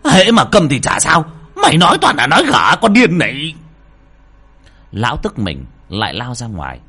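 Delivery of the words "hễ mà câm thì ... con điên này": furious yelling at the daughter, much louder; angry shout. Acted. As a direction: bark it at the girl in rage. Lão quý quát con gái. (quát giận dữ)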